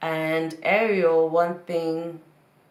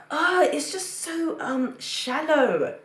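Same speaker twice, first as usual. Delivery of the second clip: high, slow, whiny drawl; drawn-out whiny voice; 9 semitones higher, much slower than usual. Retold or imitated. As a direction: stretch it out in a higher whine. Mocking someone's shallow complaint.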